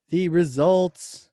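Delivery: slow, drawn-out announcer build-up; slow reveal of the poll results. Acted. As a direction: stretch it like a big reveal. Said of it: "poll reveal announcement".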